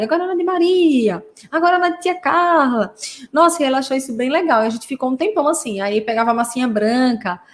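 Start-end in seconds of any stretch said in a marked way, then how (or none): 0.0-2.9 higher singsong call of a playing mother; mãe brincando. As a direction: imitate a mum calling out turns in a game. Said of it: "Aí a mãe falava". (mãe brincalhona, em tom agudo)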